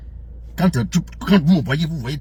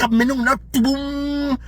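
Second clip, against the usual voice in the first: high, slow whiny mock voice; mocking Thibaut; 8 semitones higher, slower than usual. Ranting man mimics his rival Thibaut, high and slow. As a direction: mimic the rival's excuse in a silly high drawl. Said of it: "Et il dit".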